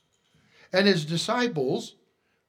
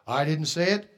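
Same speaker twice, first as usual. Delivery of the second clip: ordinary talk, nothing marked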